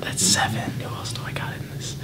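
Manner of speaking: whispered to himself; whispering. Real, not acted. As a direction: whisper the count under your breath. Counting to himself while thinking.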